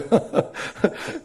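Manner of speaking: breathy burst of laughter at his own story; unvoiced laugh. Real, not acted. (자기 이야기에 터지는 웃음)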